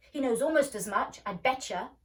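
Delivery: loud cocky insistence; boy insisting. A narrator's reading. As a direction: bet on it loudly.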